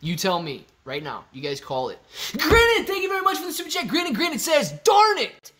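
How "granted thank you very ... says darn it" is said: high, loud burst of thanks; excited shout. Real, not acted. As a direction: yell the name out with glee. Excited super chat shout-out.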